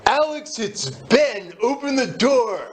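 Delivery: voiced high and drawn out through the door; boss at the door.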